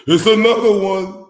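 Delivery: loud, high hype-man shout. Retold or imitated. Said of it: "in my [name] voice".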